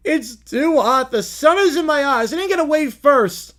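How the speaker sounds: loud, high whining complaint; whiny crying. Acted. Caillou crying on the float in the hot sun. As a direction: whine it like a crying little boy. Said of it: "Caillou is crying".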